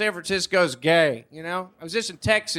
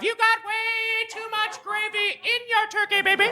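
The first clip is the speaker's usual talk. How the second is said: very high sing-song drawled scolding; sassy mom; 14 semitones higher than usual. Pretend. Mother scolding cocky son, high sing-song sass. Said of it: "You don't want him to drive".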